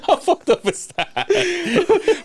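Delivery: can barely get it out for laughing. Real, not acted. Friend reacts to a dark joke, real laughter.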